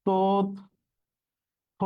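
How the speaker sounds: তথ্য said very slowly, the থ doubled as ত-থ; careful drawn-out তথ্য; slow demo pronunciation. Shown as a demonstration. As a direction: stretch out তথ্য syllable by syllable, ত then থ. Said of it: "এর উচ্চারণ হচ্ছে".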